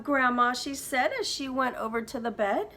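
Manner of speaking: put-on lively read-aloud for kids; story narration. A narrator's reading. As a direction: tell it like a bedtime storybook. Teacher reads story aloud.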